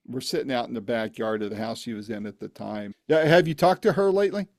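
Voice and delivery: ordinary talk, nothing marked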